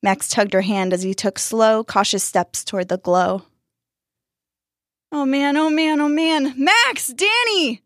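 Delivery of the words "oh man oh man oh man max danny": high, frantic calling out; panicked yelling. A narrator's reading. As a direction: panic building into cries of their names. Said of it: "yelling into the night".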